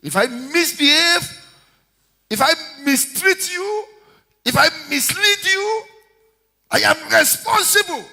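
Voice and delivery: high, ringing preaching lines; preacher's rising refrain; rising preacher cadence. Acted. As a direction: build each if up to the pulpit. Preaching build.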